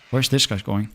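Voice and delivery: normal talking voice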